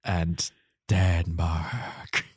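drawn out very slowly; slow dramatic emphasis. Acted. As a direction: stretch each word out. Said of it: "That's right".

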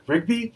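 "Rigby?" high with rising end; lightly acted concern, "Rigby" lifted into a question; questioning call. Mordecai to groaning Rigby, "Rigby?" as a high rising question.

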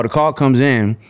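ordinary talk, nothing marked

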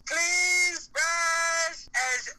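slow, solemn funeral promo; wrestling promo. Retold or imitated. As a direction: announce it like a ring eulogy. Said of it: "I was cutting a promo".

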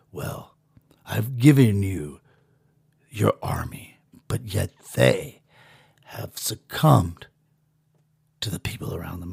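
low, slow, breathy whispered oracle; whispering overlord. Acted. The pill overlord reproaching him, in a slow whisper. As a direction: hush it like a mysterious overlord.

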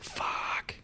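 long, breathed-out whispered curse; retold hushed dismay. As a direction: curse under your breath. Retold dismay after hitting deer.

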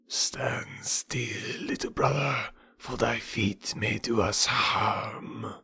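soft, slow unvoiced hiss; cobra whisper. A narrator's reading. Cobras softly warning the boy to keep still. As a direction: warn him in a hushed snake voice.

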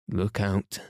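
understated warning. A narrator's reading. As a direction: warn them, without raising your voice. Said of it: "in a warning voice".

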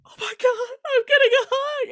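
high, loud squeal of disbelief; gushing, high-pitched mock astonishment; overjoyed amazement. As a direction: mimic friends shrieking with joy. Friends amazed at rare hug.

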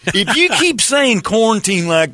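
high-pitched, indignant hillbilly rant. Pretend. Hammed-up hillbilly spelling quarrel.

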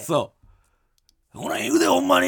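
Kansai comedian voice, pushed out in a loud hoarse whisper; Miura Mild impression. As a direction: do a Kansai comedian taking the stage. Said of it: "あー、マイルドさんだ。".

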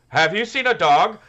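put-on high, loud little-kid voice. Retelling the neighbor kid asking about a dog, kid voice. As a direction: pitch it up like a small boy. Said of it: "I'm trying to do a kid voice".

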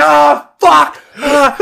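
high, pained retold cursing; pained yell. Storyteller acting out rolling around in pain, yelled. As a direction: cry out as if hurt on the floor.

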